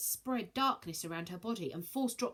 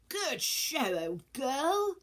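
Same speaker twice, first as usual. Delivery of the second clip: put-on higher plummy old gent; posh old gent; 6 semitones higher, 4 dB louder, much slower than usual. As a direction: praise her like a proud old gent. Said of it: "said Zeus softly".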